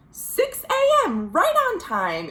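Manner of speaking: Amos chirps back to the bus driver; cheerful Amos reply. Acted. Amos greeting the bus driver every morning, light cheerful reply. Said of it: "Amos would reply".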